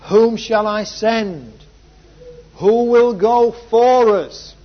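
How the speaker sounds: booming divine summons; God. Acted. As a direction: call out slowly and loudly, a summons to all. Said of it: "Then I heard the voice of the Lord saying".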